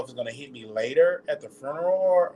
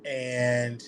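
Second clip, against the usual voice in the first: normal talking voice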